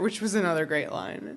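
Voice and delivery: ordinary delivery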